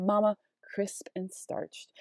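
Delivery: mama, crisp and starched drawn out slowly; slow savoring emphasis. Shown as a demonstration. Teacher to students, savoring the shifted adjectives slowly. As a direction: linger over crisp and starched. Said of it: "now we have".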